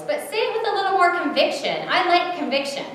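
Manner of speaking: high, loud enthusiastic coaching; lifted, emphatic exclamation; exclaiming. A narrator's reading. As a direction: urge it on excitedly. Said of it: "exclaimed the voice".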